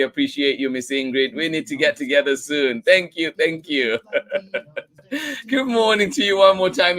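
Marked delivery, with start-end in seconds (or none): 4.7-7.0 higher, louder hello through a laugh; laughing greeting. Real, not acted. Host greeting a returning viewer, laughing. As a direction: laugh as you say good morning again.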